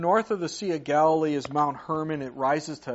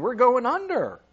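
higher and louder, a sudden alarmed call; panicked cry; 8 semitones higher, 8 dB louder than usual. Retold or imitated. Preacher echoing the sinking disciples, high loud alarm. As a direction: blurt it like the boat is sinking.

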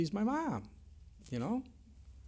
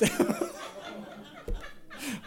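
high, breathless, silent laugh; preacher cracking up at his own story, breathless laugh; breathy laugh; 4 dB quieter than usual. Real, not acted. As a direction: let the laugh escape in air.

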